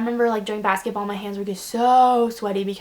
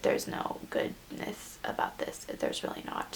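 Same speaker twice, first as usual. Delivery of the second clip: muttered; 10 dB quieter than usual. Real, not acted. Deadpan retraction.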